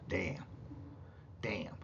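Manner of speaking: low, hushed quote of a stunned man; muttered reaction. Retold or imitated. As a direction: mutter it low under your breath, imitating him. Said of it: "Chuck goes".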